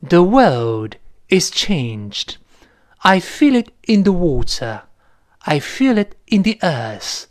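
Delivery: slow, measured British film-prologue recitation; thick British accent. Retold or imitated. Teacher quoting the Lord of the Rings prologue, thick British accent. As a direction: recite in British English, no curled r, paused between phrases. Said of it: "浓浓的英式发音".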